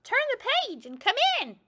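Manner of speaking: high, fast, eager bird chatter; excited birds. Acted. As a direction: birds calling her in, quick and high. Birds invite her in.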